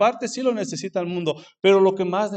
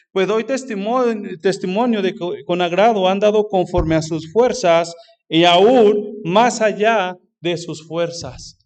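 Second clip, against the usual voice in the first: lee el versículo lento y fuerte, recalcando cada palabra; lectura enfática; 6 dB louder, slower than usual. Acted. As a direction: proclama la escritura despacio y con fuerza. Lee versículo con énfasis. (lectura bíblica lenta y enfática)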